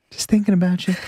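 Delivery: high, breathy late-night whisper of affection. Pretend. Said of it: "weird M J monologues".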